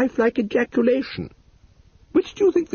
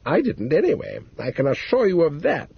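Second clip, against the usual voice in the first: icy; 4 semitones higher than usual. A narrator's reading. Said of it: "she said, icily".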